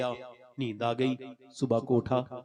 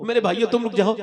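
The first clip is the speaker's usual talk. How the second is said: higher, louder pleading call; dead man's cry; 8 semitones higher, 8 dB louder, faster than usual. Retold or imitated. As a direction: beg them to stop, raising the voice. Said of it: "ओ वो ऐसे चीखता है". (ऊँची आवाज़ में रोकने की पुकार)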